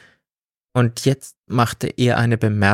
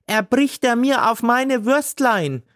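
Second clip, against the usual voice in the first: high, nonstop angry tirade; ranting seller; 13 semitones higher than usual. A narrator's reading. Gailana rants at God about her ruined sausages, high and angry. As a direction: pour out a furious torrent.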